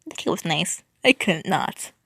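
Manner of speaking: ordinary delivery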